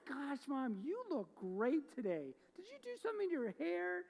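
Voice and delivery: high sugary flattery of mom; flattering child. Retold or imitated. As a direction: gush over mom to butter her up. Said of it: "going to your mom or your dad".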